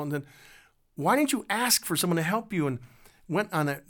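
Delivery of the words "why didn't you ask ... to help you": higher, reproachful grandmother question; shaming scold. Retold or imitated. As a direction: shame the child as you ask. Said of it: "just shaming this little girl".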